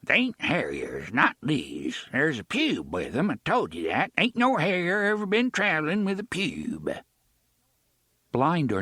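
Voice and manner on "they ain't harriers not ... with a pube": put-on thick rural accent. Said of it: "he spoke with a thick accent".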